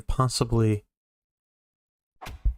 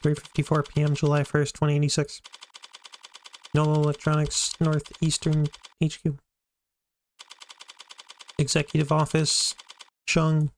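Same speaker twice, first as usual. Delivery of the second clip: higher, measured scene header; scene setting narration; 4 semitones higher than usual. Acted. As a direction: announce time and place like a title card. GM sets the scene.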